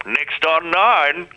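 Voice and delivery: slow, high TV announcer voice; voiceover announcer. Retold or imitated. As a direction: promo voiceover, drawn out. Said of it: "Pete Smith's old job".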